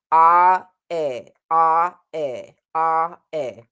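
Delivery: "Ah, eh" swapped with only the tongue moving; alternating ah and eh. Shown as a demonstration. Coach showing the vowel alternation exercise. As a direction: switch "ah" and "eh" with lips and jaw still. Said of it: "try alternating between the two".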